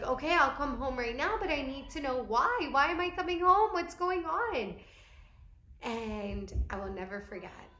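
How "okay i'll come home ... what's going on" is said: higher and louder, rising into panic; worried, pressing for answers; anxious pleading. Retold or imitated. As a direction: demand answers, frightened. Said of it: "And I was like".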